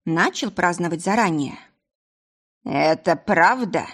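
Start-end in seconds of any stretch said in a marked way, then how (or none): 2.6-3.8 put-on slow, slurred drunken drawl; barely moving the tongue, drunk; slurred drunk mumble. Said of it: "промычал, еле ворочая языком".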